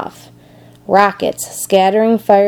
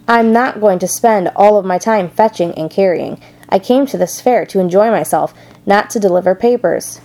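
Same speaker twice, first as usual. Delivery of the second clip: ordinary delivery, nothing marked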